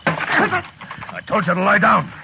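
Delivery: slow, loud, high-pitched command; angry shout. Acted. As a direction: force him back down with your voice. Marshal barks at the prisoner.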